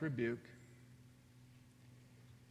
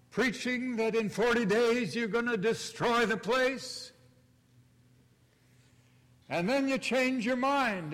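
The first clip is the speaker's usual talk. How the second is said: loud, high indignant ranting; angry Jonah; 6 semitones higher, 5 dB louder than usual. Acted. Jonah protests to God, loud and furious. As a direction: rail at God like a wounded prophet. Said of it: "He's furious".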